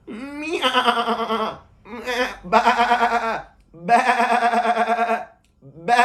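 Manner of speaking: high loud bleating; sheep bleat. Pretend. Says he became a sheep.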